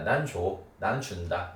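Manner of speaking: usual speaking voice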